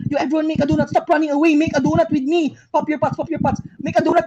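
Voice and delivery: ordinary talk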